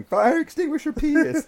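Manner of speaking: loud high mock yell; gleeful shouted yell. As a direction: whoop it out like horsing around.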